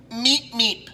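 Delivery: squeezed mock meep, lightly voiced. Host repeating Beaker's meep, light squeaky imitation. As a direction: meep again like the timid lab assistant. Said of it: "and his name is Beaker".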